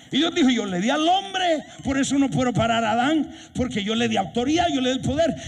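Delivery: high-pitched, driving sermon delivery; fiery preaching. Real, not acted. Preacher exhorting his church to take authority, fervent and high. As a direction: preach it with full fervour.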